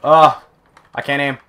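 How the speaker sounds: usual speaking voice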